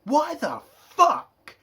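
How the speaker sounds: loud, high-pitched shout of disbelief; incredulous yell; exasperated outburst. Retold or imitated. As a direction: explode in incredulous outrage, stretching the swearing. Acts out exasperated reaction to scene.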